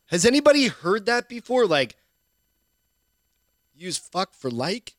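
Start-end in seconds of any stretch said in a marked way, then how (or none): 0.1-1.7 baffled question. Retold or imitated. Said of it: "I'm like".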